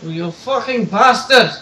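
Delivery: slow, loud Irish accent curse. Shown as a demonstration. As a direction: spit it out in a stage Irish accent. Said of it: "Try to say it in a Irish ba-, accent".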